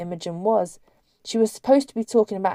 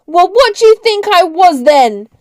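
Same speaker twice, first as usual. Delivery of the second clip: loud, high indignant yell; high-pitched, full-voiced shout; yelling after someone; 10 semitones higher, 12 dB louder than usual. A narrator's reading. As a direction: yell it at someone walking away. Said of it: "Sally yelled after him".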